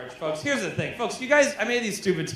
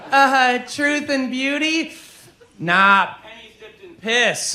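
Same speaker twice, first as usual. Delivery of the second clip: lightly put-on higher, slow voice; slightly hammed-up slow reply, pitched up; mock hesitant answer; 8 semitones higher, 4 dB louder, slower than usual. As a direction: act out a drawn-out, searching answer. Acted answer to his bisexual question.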